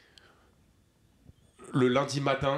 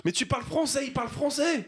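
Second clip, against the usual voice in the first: much higher-pitched, exasperated objection; heated protest; 14 semitones higher than usual. Real, not acted. Teasing a friend about not picking up the accent, heated protest.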